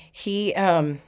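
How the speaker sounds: ordinary talk, nothing marked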